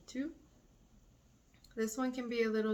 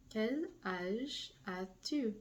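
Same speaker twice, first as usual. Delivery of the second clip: Quel âge as-tu said slowly; slow model question; slower than usual. Shown as a demonstration. Teacher slowing the informal question for students. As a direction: draw it out for learners. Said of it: "Now with two".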